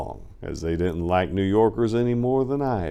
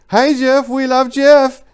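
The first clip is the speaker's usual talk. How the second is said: put-on much higher, louder girlish call; girl soldiers calling out; 11 dB louder, slower than usual. Girl soldiers passing by call out to Jeff, high and loud. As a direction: mimic a gang of girls cheering his name. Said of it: "a unit of girl soldiers would walk by all".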